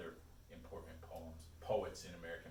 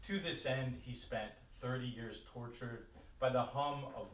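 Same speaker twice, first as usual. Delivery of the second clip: poetry reading; 3 semitones higher, 4 dB louder than usual. Acted. Reads own poem aloud.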